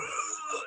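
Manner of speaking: soft, voiceless wheezing groan; imitated wheeze. Imitating the fake grandfather on the couch, wheezing. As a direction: wheeze like a sick old man. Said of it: "he's wheezing, he's going".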